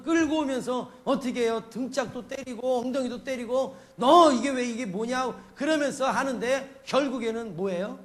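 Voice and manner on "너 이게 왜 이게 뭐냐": loud burst of parental reproof; mock scolding. God dragging a straying child back, mock scolding. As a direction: tell off a wayward child, raised voice.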